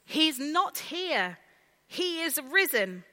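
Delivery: loud, slow, jubilant proclamation; joyful Easter cry; triumphant announcement. Acted.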